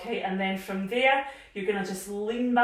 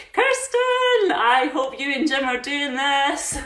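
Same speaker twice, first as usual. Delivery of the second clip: calling out; 3 semitones higher, 7 dB louder, slower than usual. Real, not acted. Calling out to a participant online.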